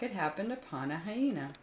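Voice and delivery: usual speaking voice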